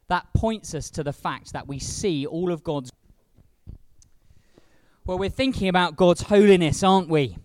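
ordinary delivery

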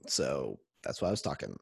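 audio cutting out; faint, cut-out line; muted words. Real, not acted. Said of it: "it cut out".

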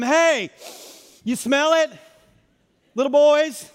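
high, loud teasing holler; yelling from afar. Acted. Preacher acts Jesus hollering to the fishermen, playful yell. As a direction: call out to people across the water. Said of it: "And then yells from them".

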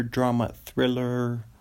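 ordinary talk, nothing marked

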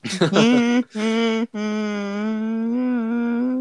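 a long hammed-up wail, high and loud; fake crying burst. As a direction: burst into a mock sob to imitate the YouTuber. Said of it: "What was that?".

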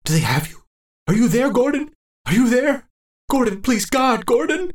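high, desperate pleading; frightened old man searching for his friend; terrified calling. Acted. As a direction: call out for Gordon in rising panic.

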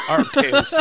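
mock Dude echo. Echoing the Dude's order.

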